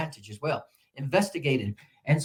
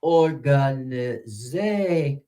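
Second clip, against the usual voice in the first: very slow, drawn-out "Organization", syllable by syllable; teacher's slow-motion "Organization"; much slower than usual. Shown as a demonstration. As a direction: stretch "Organization" out, lingering on "za". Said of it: "Slow it down".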